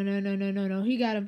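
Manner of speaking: normal talking voice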